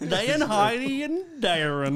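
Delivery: high, hammed-up Irish accent; mock Irish accent. Host reading listener's letter, mock Irish accent. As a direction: ham up the Irish, pitched high. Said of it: "read this in the accent".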